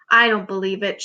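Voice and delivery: ordinary delivery, nothing marked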